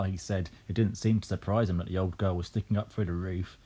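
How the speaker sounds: plain reading voice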